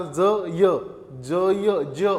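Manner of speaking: ज said as j with a y sound after it; ज with a य glide. Shown as a demonstration. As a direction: let the ज slide into य. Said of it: "दुसरा कसा होणार".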